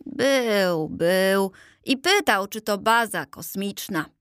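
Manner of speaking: ordinary delivery, nothing marked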